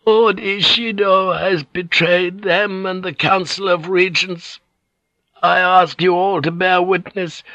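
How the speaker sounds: put-on high, faltering, solemn old voice; hoarse, frail old lady. A narrator's reading. Wavering old lady accuses Ishido before witnesses, frail and solemn. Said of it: "her voice hoarse and frail".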